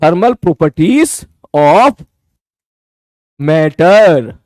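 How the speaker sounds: stretched word by word, waiting for pens; very slow dictation. Shown as a demonstration. Teacher repeating the title very slowly for students writing. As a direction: spell it out slowly to the class.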